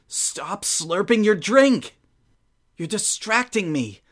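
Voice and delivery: high, loud, drawn-out snapped shout; frustrated yell. Acted. Frazzled player yelling at Applejack over her slurping. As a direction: yell it at the table in exasperation.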